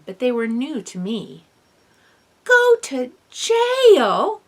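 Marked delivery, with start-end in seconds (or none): 2.4-4.4 high, slow, loud shriek. A narrator's reading. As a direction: shriek it out in shock. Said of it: "I shrieked".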